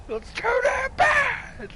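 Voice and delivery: very high, loud, drawn-out yell; hammed-up scream of someone who has had enough; mock furious shout. Player voicing a fed-up companion turning back, mock furious shout. Acting fed-up character snapping.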